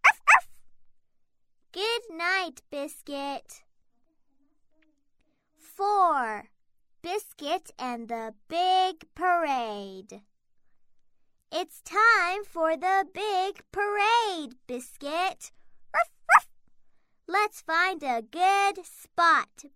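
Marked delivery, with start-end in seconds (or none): 0.1-0.5 puppy barking. Acted. Puppy barks. 15.9-16.5 puppy barking. Acted. Puppy barks at parade.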